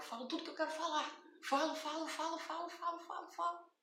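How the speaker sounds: rapid venting. Shown as a demonstration. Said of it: "falo comigo mesma, eu me gravo".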